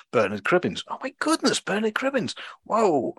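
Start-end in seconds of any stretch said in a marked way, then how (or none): 0.9-1.6 much higher, thrilled oh my goodness; genuinely floored by the cast; amazed gasp. Real, not acted. 1.6-2.4 Bernard Cribbins said much higher in delight; excited. Real, not acted. Amazed at the cast list. 2.6-3.1 impressed whoa. Real, not acted. Amazed at the cast list.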